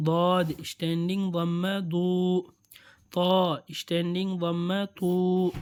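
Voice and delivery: slow, measured chanting of letter sounds; sing-song Qur'an reading practice; recitation drill. Shown as a demonstration.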